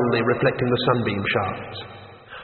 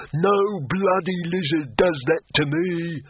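roaring; 5 semitones higher, slower than usual. Acted. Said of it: "he roared".

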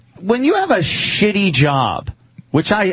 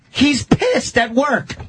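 ordinary delivery

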